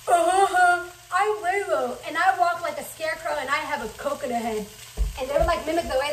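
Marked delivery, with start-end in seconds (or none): 0.1-2.0 loud, high sneering mimicry; mocking mean girls. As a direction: taunt her name like a bully.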